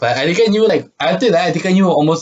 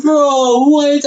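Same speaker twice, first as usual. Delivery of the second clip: high, loud retold outburst; shocked disbelief; 12 semitones higher, 4 dB louder than usual. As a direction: blurt it out in stunned disbelief. Retelling shocked reaction to prank.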